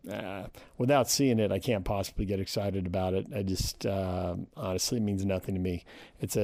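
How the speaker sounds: normal talking voice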